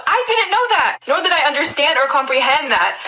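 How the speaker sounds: high-pitched indignant denial; emphatic protest. Retold or imitated. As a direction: protest it with the voice leaping up. Protests as her twelve-year-old self.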